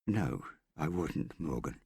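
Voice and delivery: slow, soft reassurance; calm an agitated man gently; soothing. A narrator's reading. Hester reassures Morgan she would never steal, soothing. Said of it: "she said in a soothing voice".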